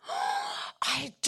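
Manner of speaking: gasp, then wail it in hammed-up remorse; exaggerated crying confession; acted wailing admission. Acts out her tearful admission.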